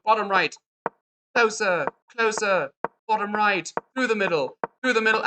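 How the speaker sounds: high, put-on English accent. Chess coach calling out rook moves, in a mock English accent. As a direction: keep up a playful English accent, pitched high. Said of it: "we're developing an English accent".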